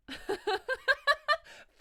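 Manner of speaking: high, loud burst of laughter; big high-pitched cackling laugh. Real, not acted. Laughing at a rude chat message.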